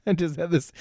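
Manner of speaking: normal talking voice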